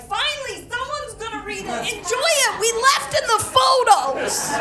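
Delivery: high, sing-song mock delight; sarcastic relief. Comic silently mocking the judge about finally reading her file, high and sarcastic. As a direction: chirp it high with sarcastic glee.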